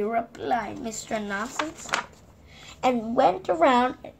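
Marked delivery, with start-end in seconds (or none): none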